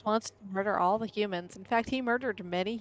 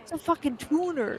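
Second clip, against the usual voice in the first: higher, exasperated yell from the viral Dave and the Tuna video; Tuna guy impression; 7 semitones higher than usual. Streamer quoting the Dave and the Tuna guy, higher mock yell. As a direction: belt it out like the annoyed guy holding a tuna. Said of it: "that video where the guy's like".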